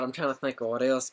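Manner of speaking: normal talking voice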